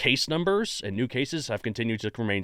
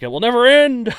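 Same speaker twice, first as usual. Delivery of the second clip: higher, louder hammed-up despair; mock doom; 12 semitones higher, 11 dB louder than usual. Host to guest about the pandemic, hammed-up doom. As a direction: wail it like a melodramatic prophet.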